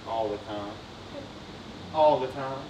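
very low, soft aside; quiet admission. Real, not acted. Preacher admits how often we doubt Jesus, quietly. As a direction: let the voice sink low and soft. Preacher answers his own rueful question.

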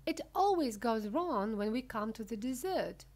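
usual speaking voice

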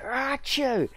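exaggerated high sneeze; a big theatrical sneeze; loud fake sneeze. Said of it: "I'm a bit like".

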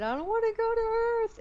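high, loud pleading cry. Retold or imitated. Under hypnosis, telling the hypnotist, pleading loudly. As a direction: beg not to be sent. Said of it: "And I said".

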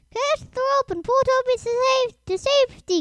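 high, loud rescue call; urgent, high-pitched holler. A narrator's reading. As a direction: shout instructions down from above. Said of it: "called Harold".

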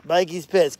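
ordinary delivery

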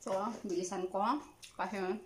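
chewing while talking; biting into tofu; mouth full. Real, not acted. Seen 3: Chews before speaking, then bites tofu at 0.8s while talking.

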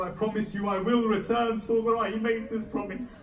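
high, loud majlis lament; impassioned preaching. Acted. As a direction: drive the farewell scene with full fervour. Climax of sermon narration.

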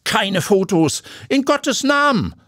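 high, urgent pleading; frantic begging; desperate plea. Acted. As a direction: stop her camera in panic. Owner forbids photos.

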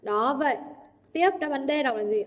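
ordinary talk

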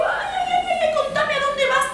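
very high, busy zebra voice; a running zebra calling out ay ay ay; chattering zebra. Acted. Señora Zebra running up to Chanchito, very high and chatty.

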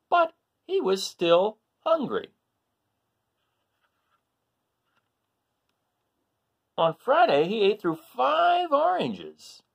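animated picture-book narration for a class; reading to children. Acted. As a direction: read the list with growing excitement. Reads story to children.

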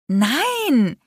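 sharp, high alarmed denial; alarmed cry. A narrator's reading. Vi denying the affair, alarmed cry. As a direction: blurt it out in panic. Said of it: "schrie ich alarmiert".

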